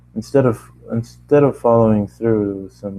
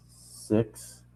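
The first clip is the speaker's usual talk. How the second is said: ordinary delivery, nothing marked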